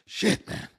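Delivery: high whooshed whew, then breath-only words; big mock sigh of relief; acted relief. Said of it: "supposed to be relieved".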